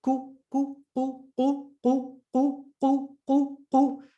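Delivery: ku repeated high and quick, tongue pulled back; teacher drilling ku; corrected qu drill. Shown as a demonstration. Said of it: "bring the tongue back a bit".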